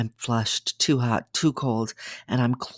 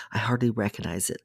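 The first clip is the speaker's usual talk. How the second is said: plain reading voice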